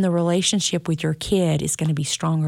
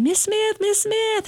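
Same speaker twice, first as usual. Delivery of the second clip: put-on much higher, eager children's call; excited kids spotting their teacher in town; slower than usual. Kids calling teacher in public.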